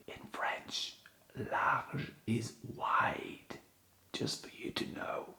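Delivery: soft whispered aside. Real, not acted. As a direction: lean in and whisper it like a secret.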